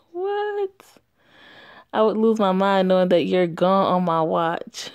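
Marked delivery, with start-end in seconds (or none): 0.1-0.7 much higher, caught-off-guard what; startled what. Acted. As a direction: squeak it out in surprise. Character reacts in disbelief.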